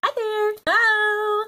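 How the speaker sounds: big bubbly hello; cheery high greeting. Real, not acted. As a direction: greet viewers brightly and high. Cheery greeting opening the video.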